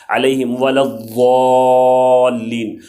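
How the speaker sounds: الضالین drawn out slowly with a heavy ض; Quranic recitation. Shown as a demonstration. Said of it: "ضالین میں ضاد ہے وہ موٹا پڑا جاتا ہے".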